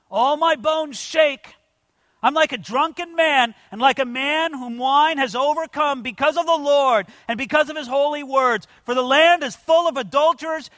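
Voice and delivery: high, loud prophetic lament; impassioned scripture reading. Acted. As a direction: cry out the prophet's anguish from the pulpit. Reads the prophet's lament at full force.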